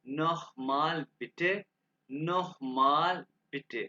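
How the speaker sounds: slow "नोह माल बिटे", kh closing "नोह"; careful pronunciation model. Shown as a demonstration. Teacher repeating the polite request for learners, slow model. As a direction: draw out each word of "नोह माल बिटे". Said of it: "इसको खे के बाद निकालेंगे".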